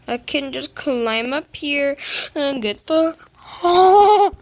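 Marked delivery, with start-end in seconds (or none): 3.6-4.4 sudden high, loud yelp; startled loud cry. Real, not acted. Gamer slipping mid-climb, startled yelp. Mishap while climbing the tree.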